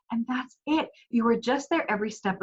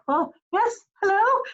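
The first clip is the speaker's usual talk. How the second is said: high, bright-eyed mock eagerness, much higher; mock eager reply; 10 semitones higher, 3 dB louder than usual. Wife answering husband's request with mock eagerness. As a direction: play up sudden cheerful attention. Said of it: "And I'll be like".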